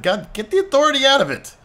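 high, odd-voiced exclamation; exasperated outburst. Real, not acted. Player reacting to the promo, high exasperated cry. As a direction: blurt it in disbelief.

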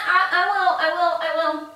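normal talking voice

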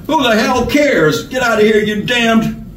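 high, tearful snarl; angry high-pitched outburst; sodden snarl. A narrator's reading. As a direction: snarl it through the end of sobbing. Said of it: "sobbing became a sodden snarl".

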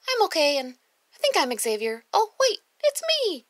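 put-on high, loud little boy, building to a squeal; child voice. Little boy reassuring his teacher, rising squeal. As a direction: play the boy realizing it's himself. Child voice, kid's silly reply.

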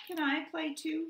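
high small voice; little bluebird. A narrator's reading. Bluebird asks Duck and Goose, small high voice. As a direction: ask shyly like a tiny bird.

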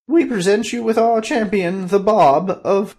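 high, loud court proclamation, slowing at the end; grand announcement. Acted. Courtiers presenting Bob to the king, loud high proclamation. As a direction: present the champion with fanfare.

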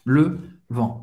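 "Le vent" ending on a nasal vowel, no T; silent T. Shown as a demonstration. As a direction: drop the T of "vent". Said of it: "você não vai pronunciar".

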